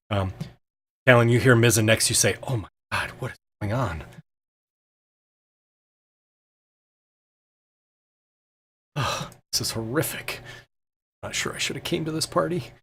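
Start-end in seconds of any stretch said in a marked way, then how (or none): 2.4-12.7 soft put-on voice of an uneasy guest; hushed, in-character dismay; horrified aside. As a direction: murmur it aside to a neighbour, appalled. Mizzen mutters aside, horrified.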